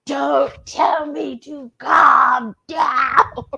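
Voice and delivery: a touch of the mother's fury, each word slow; slow furious growl, imitating mom. Imitating the mother snapping at the father, slow and seething. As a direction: drag it out slowly, a seething mother. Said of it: "fire came out of her mouth".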